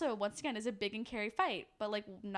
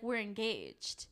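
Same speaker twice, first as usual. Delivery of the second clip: slow "We're engaged"; 2 semitones lower, much slower than usual. Retold or imitated. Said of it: "he's like".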